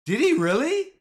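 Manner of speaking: can't believe what he's reading; disbelief. Real, not acted. To chat about the cash accounts, incredulous. Reacting to stock news on stream.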